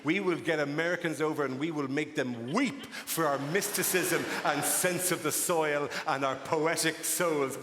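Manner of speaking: ordinary talk, nothing marked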